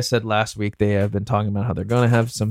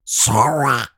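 lightly voiced cartoon duck; a hint of a quacky duck voice; Donald Duck voice. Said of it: "Donald Duck impression".

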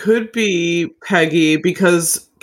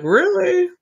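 much higher, disbelieving; incredulous really; 14 semitones higher than usual. Retold or imitated. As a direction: question it in mock disbelief. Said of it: "I'm like".

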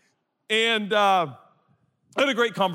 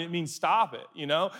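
ordinary delivery

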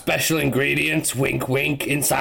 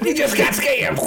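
laughing mock shout; 11 semitones higher than usual.

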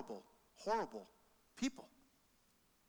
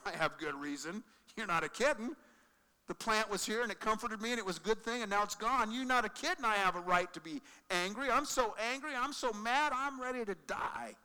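higher, louder whiny temper tantrum; Jonah's tantrum; 6 semitones higher, 5 dB louder than usual. Acted. Jonah answers God with a pity-party tantrum, whiny. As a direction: stamp your feet like a sulking child.

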